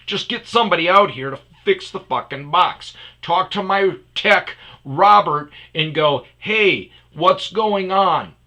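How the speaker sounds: loud, high, exasperated ordering; fed-up customer ranting at support; retold angry demand.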